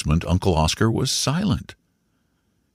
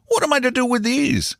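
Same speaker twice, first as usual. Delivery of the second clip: much higher, excited exclamation; crying out. A narrator's reading. Uncle waving winnings before the boy, crying out excitedly. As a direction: exclaim it high, no added volume. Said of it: "he cried, waving them".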